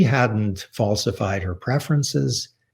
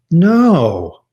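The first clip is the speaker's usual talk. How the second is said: a sharp, loud, higher no, imitating mother; mother's angry no; 6 semitones higher, 9 dB louder than usual. Mother refusing her son's topic, a loud imitated no. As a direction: snap out a flat refusal like an offended mother. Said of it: "my mother could be brutal when she was angry".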